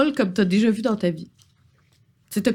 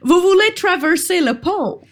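put-on high British-accented troll; courteous question in mock British-accented French; 7 semitones higher, 3 dB louder than usual. Troll questions the adventurer at his bridge, high British accent. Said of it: "charmant accent britannique".

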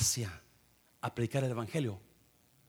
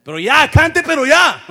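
very loud and much higher, insistent; urgent shout; 11 semitones higher, 20 dB louder than usual. Pretend. Congregation member to neighbour, shouting to sing now. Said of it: "Dígale a alguien".

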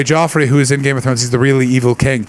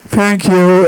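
lightly voiced timid thank you; a small awkward thanks; meek thanks; 6 semitones higher, 3 dB louder than usual. Actor waving back at his heckler, timid thanks. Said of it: "the poor guy just sort of waved like".

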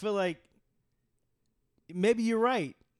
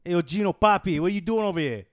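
put-on loud, higher New York accent; mock New Yorker; 5 semitones higher, 12 dB louder than usual. Challenging Gino on the block, loud New York accent. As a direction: holler it like a street New Yorker.

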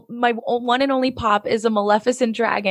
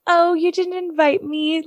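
Maleficent sass; 6 semitones higher, slower than usual. Retold or imitated. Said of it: "she's like".

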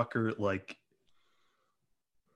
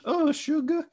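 syrupy cartoon Southern accent, pitched up; Rogue Southern accent; 4 dB louder than usual. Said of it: "She sounds like Rogue from the X-Men ... Southern accent".

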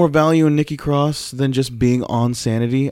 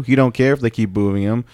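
usual speaking voice

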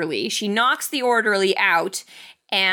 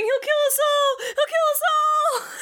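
very high frantic shout; panicked shout. Retold or imitated. Retelling Sarah's panic to the co-host, high frantic shout. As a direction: shriek it like a panicking mother. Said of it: "she's just shouting".

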